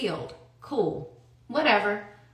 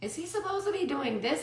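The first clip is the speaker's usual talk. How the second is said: higher, softer questioning; retold concerned question; 6 semitones higher, 9 dB quieter than usual. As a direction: ask it softly, pitched up, as a retold worry. Quotes herself asking a confidant, worried.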